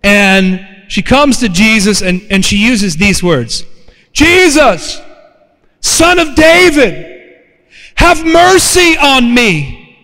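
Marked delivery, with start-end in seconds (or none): 4.1-10.0 higher, drawn-out cry for mercy; desperate plea. Retold or imitated. Woman begging Jesus for her daughter, desperate plea. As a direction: call out pleadingly, stretching the words. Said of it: "she was desperate".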